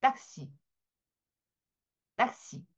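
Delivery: 딱시 with a hard tense t; second 딱시 nearly whispered; tense French taxi. Shown as a demonstration. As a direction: snap out 딱시 with a stiff tense first sound. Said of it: "좀 된 발음으로 정직하게".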